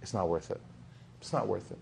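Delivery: dropped to a soft, earnest hush; quiet sincere advice. Pretend. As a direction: gently warn a friend, almost under your breath. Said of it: "tell them, like".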